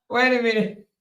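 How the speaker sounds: normal talking voice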